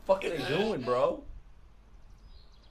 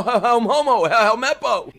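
normal talking voice

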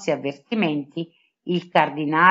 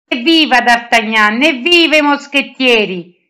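high, louder joyful cheer; happy shout; 5 semitones higher, 5 dB louder than usual. A narrator's reading. Aramis cheers the new musketeer lieutenant, a high happy shout. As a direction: cheer it out, thrilled. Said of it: "gridò Aramis felice".

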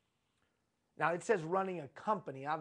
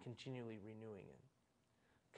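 "Continually renewing in" said slowly, much softer; soft slow echo; 7 semitones lower, 15 dB quieter, slower than usual. Shown as a demonstration. Repeating the key phrase to the audience, hushed and slow. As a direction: hush it and slow down. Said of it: "echoed phrase".

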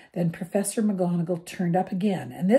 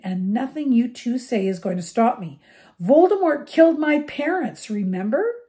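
higher and louder fierce plea; passionate outburst; 5 semitones higher, 7 dB louder than usual. A narrator's reading. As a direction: rage to make them see. Harry's impassioned outburst at friends.

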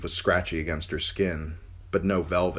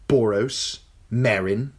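put-on high, sneering young king; cruel boy king; 5 semitones higher, 4 dB louder, slower than usual. King taunting the captive girl, high and sneering. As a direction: gloat down at her, spoiled and cruel.

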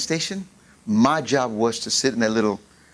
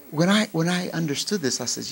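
usual speaking voice

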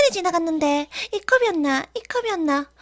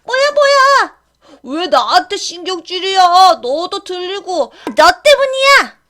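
angry quarrel; 7 dB louder than usual. Acted. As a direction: blame each other furiously. Ghost team quarrels after losing.

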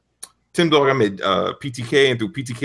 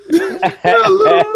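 laughing; 6 dB louder, much slower than usual. Real, not acted. Laughing at remark.

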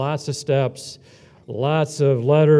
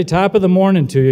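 demo greeting; 6 semitones higher, 8 dB louder than usual. Shown as a demonstration. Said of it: "when they say".

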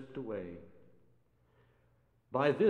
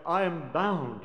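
crying out; 5 semitones higher, 6 dB louder than usual. Acted. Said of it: "she cried".

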